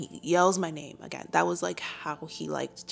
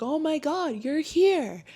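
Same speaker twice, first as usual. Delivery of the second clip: much higher, louder gushing; mock excited greeting; 10 semitones higher, 4 dB louder, slower than usual. Veterans greeting the counselor back, hammed-up excitement. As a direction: squeal it like the group overjoyed. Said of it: "They're all like".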